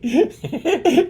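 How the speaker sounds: high-pitched quick chuckle; sudden amused giggle; short laugh. Real, not acted. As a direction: break into a brief high laugh. Laughs at the story.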